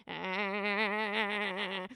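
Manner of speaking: long monotone "aaah"; imitated drone "ahh". Streamer imagining the sound of that emo, drawn-out drone. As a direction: imitate a noise by holding one vowel. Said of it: "That's what I imagine looking at".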